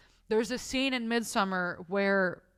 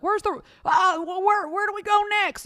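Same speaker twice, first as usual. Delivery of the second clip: very high, louder mock tourist voice; hammed-up high-pitched stammering; oblivious passing family; 12 semitones higher, 6 dB louder than usual. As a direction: squeal it like a flustered tourist. Mimicking families walking past.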